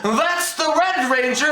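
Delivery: high, drawn-out mock shout; mocking loud narrating. Moviegoer mimics mom narrating the whole movie, mocking high shout. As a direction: imitate an obnoxious moviegoer announcing everything. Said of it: "She narrated the whole fucking movie".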